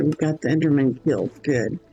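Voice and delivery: ordinary talk, nothing marked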